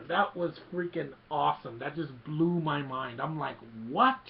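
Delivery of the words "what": high, loud rising "what"; acted astonishment; shocked disbelief. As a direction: blurt it out, re-enacting mind-blown shock. Acting out own shocked reaction.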